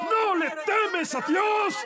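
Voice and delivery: high, drawn-out cry; crying out in rebuke. Acted. Thief rebuking the other thief, high cry. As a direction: throw the question out high and long. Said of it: "le dije a él".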